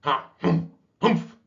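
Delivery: high, loud startled humphs; startled snorts. Acted. Old walrus snorting awake, loud startled grunts.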